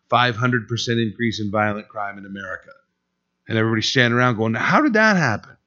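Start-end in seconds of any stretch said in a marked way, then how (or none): 4.5-5.6 high-pitched, swooping puzzled question; mock bewilderment. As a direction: mimic clueless bystanders asking in disbelief. Mimicking baffled bystanders.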